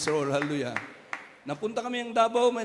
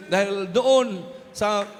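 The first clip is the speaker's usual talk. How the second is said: loud, high revival preaching over music; fervent shouted sermon; full-force preaching; 4 semitones higher, 6 dB louder than usual. Acted. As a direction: proclaim God's will to the congregation.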